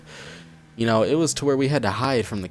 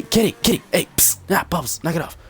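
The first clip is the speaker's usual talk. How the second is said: high sharp call at the cat, then a hiss; real scolding of cats mid-story; scolding pets; 8 semitones higher, 5 dB louder than usual. Real, not acted. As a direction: snap at the pets to stop.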